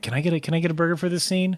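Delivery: fast, pushy burger request; mock actor voice. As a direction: rattle it off like a hungry actor. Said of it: "that actor should have been like".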